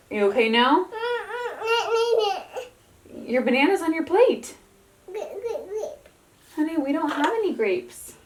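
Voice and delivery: high, soft voice for a little one. Real, not acted. Mom soothing her toddler at the table, high soft voice.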